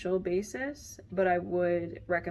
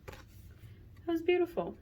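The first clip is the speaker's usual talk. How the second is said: awed and tender; 11 semitones higher, slower than usual. Real, not acted. Admiring the card's words.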